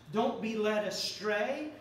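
normal talking voice

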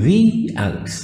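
"the" said as "thee" before the vowel, slowly; slow teacher demo. Shown as a demonstration. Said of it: "لما يجي بعدها حرف متحرك".

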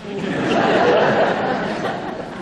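loud, high burst of laughter; real hearty laugh. Real, not acted. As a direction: laugh out loud at the joke about Jeff. Laughing at his own joke.

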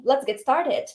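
ordinary talk

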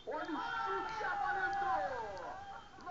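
rising excited goal shout; goal call. Real, not acted. Goal call in match commentary.

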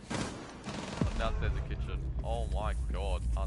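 ordinary talk, nothing marked

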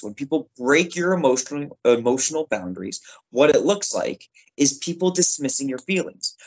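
ordinary talk, nothing marked

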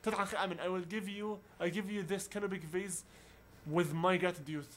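ordinary talk

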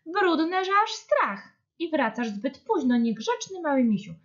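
high-pitched motherly telling-off; scolding. A narrator's reading. Deer's mother to the dirty little bear, scolding. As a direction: tell off a small child, pitched high.

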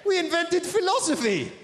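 put-on very high, proud Greek accent; indignant teen talking back; high, huffy Greek accent. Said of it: "the Greek accent".

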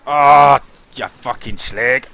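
loud drawn-out curse at the screen; furious gamer outburst; angry yell. Real, not acted. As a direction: let it rip at the game. Raging at the game.

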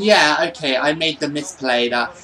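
usual speaking voice

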